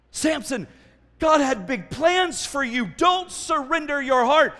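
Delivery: high, loud warning cry; urgent plea. Acted. Preacher warning Samson not to surrender, loud urgent plea.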